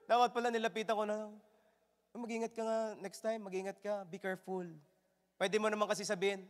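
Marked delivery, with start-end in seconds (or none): 2.1-4.7 very soft, restrained caution; hushed, mild admonition; no shouting. Shown as a demonstration. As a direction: gently advise instead of yelling. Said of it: "Ba't kailangan sumigaw?".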